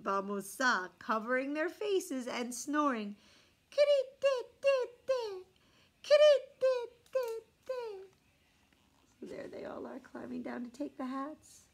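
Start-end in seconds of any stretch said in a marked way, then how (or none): 3.7-8.1 very high, slow mock snore; squeaky pretend snoring of monkeys. A narrator's reading. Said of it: "pretending to be snoring". 9.2-11.7 soft unvoiced aside; hushed aside to listeners; whisper. Real, not acted. Aside while showing picture.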